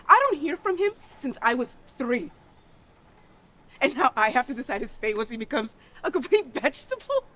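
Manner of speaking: high, loud, incredulous protest; angry yelling. Acted. Child must decide absent father's fate, angry. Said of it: "I'm sorry for yelling at you".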